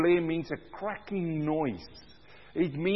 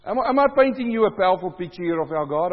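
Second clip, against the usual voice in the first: ordinary talk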